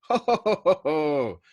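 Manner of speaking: big, loud, high booming ho ho ho; jolly belly laugh. Acted. Arfi laughing off Nately's plan, indulgent. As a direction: laugh him down, hugely amused. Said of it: "Arfi's indulgent merriment swelled tremendously".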